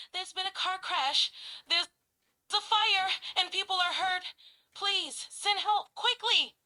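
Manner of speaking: high, loud, frantic plea; frantic emergency call. Acted. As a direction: desperately call for help. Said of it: "someone might sound frantic".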